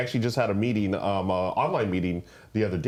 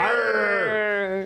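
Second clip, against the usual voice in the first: pirate arr; 10 semitones higher than usual. Pretend. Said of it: "I don't know what accent that is".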